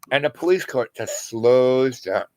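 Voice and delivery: usual speaking voice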